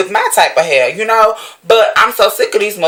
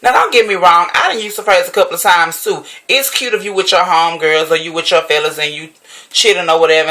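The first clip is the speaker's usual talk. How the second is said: ordinary talk, nothing marked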